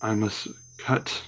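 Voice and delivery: soft, low hushed plea of a sword; quiet whispering sword voice. Acted. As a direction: murmur it like a sentient blade. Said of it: "the sword whispers to Euphemion".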